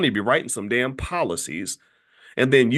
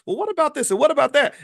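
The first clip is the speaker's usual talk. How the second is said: high, insistent imitation of an interviewer; mock pestering, pitched up high; badgering questioner; 12 semitones higher, 5 dB louder, faster than usual. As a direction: fire questions at him, louder on the second. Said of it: "asking him all these questions".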